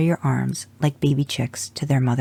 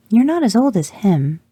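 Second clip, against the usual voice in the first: put-on high, louder little-girl blurt; 8 semitones higher, 5 dB louder than usual. A narrator's reading. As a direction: let it tumble out of the girl. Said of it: "then blurted".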